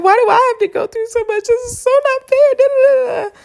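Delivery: very high, hammed-up whine; high mock tantrum, trailing into nonsense syllables; mock whining. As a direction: whine like it's so unfair. Acting out a self-pitying complaint.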